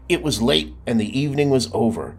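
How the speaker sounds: usual speaking voice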